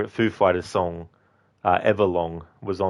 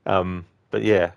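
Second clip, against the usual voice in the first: usual speaking voice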